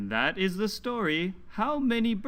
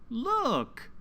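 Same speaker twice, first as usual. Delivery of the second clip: a high, bright call of look; excited look; 6 semitones higher than usual. Acted. Storyteller showing children a new bird, excitedly high. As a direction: point it out with a sudden burst of delight.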